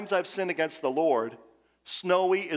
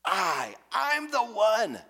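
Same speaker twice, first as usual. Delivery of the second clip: slow, higher voice leaning hard on I, I; emphasized I; 7 semitones higher, much slower than usual. Shown as a demonstration. Preacher demonstrates the double I to his congregation, slow and emphatic. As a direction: stretch out I, I'm the one, pressing the first I. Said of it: "Whenever it has that double I, he's emphasizing it".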